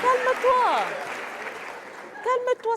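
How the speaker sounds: very high, loud exclamation; high-pitched, urgent shout over a quarrel; shouted calming order. Acted. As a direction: cry out to make him calm down. Shouts at colleague to calm down.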